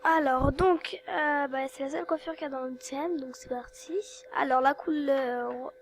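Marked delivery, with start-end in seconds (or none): 3.2-3.9 whisper. Real, not acted. Starts game round in whisper.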